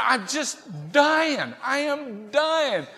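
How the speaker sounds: high, dramatic lament; hammed-up suffering, drawn out at the end; mock agony. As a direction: wail it in comic misery. Acted agony in the car.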